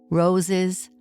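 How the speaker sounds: plain reading voice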